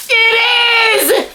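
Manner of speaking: high, drawn-out shout; delighted squeal. Real, not acted. Vlogger showing the adoption she won, delighted squeal. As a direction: squeal the reveal.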